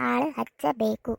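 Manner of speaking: ಆಳು ಹಚ್ಚಬೇಕು stretched out word by word; slow insistence. Acted. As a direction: spell out the demand at half pace. Wife insists on hiring a servant. (ನಿಧಾನವಾಗಿ ಒತ್ತಿ ಹೇಳುವ ಹಠ)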